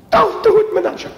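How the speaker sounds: very high frantic plea; deputy desperately begging Menashe to keep the secret; panicked pleading. Acted. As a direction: squeal it out in alarm.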